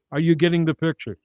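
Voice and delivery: ordinary talk, nothing marked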